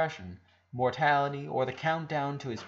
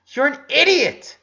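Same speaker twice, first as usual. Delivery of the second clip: angry retort; 12 semitones higher, 12 dB louder than usual. Acted. Ronnie Ann shouting back.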